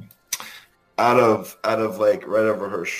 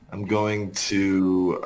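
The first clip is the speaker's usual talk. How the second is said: ordinary talk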